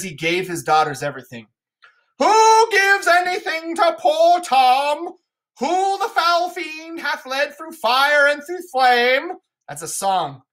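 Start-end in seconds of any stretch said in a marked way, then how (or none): 2.2-9.3 much higher, slow, louder raving beggar voice; mad poor Tom. Acted. Edgar disguised as poor Tom raving at Lear, high and slow. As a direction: rave like a madman fleeing fiends.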